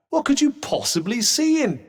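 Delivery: high, incredulous protest; appalled disbelief. Acted. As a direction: protest as if utterly baffled. Disbelief at someone's choice.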